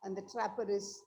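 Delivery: very faint and far from the mic; speaking so softly it's hard to hear; barely audible. Real, not acted. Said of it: "your voice is low".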